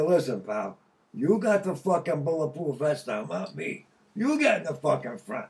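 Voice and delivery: loud, pushy retold command; retold order. To the guy with the vest, a loud demand. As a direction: shoves the job back on him.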